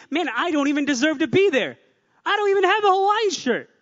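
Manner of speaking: high, loud protest of unworthiness; mock self-doubt. Preacher voicing a guy doubting he belongs at men's brekkie, mock self-doubt. Acting out a hesitant guy's thoughts.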